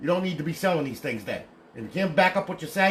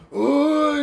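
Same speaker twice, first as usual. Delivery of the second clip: mocking quote; 7 semitones higher, 4 dB louder, slower than usual. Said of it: "people say".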